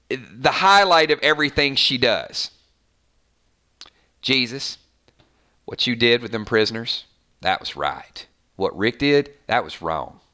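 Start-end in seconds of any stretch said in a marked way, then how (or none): 4.2-10.2 low, soft Southern drawl, dropping deep on each verdict; mock a solemn Southern drawl, sinking at phrase ends; Maggie's drawl. Fan mimics Maggie judging Jesus and Rick, low Southern drawl. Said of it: "that drawl".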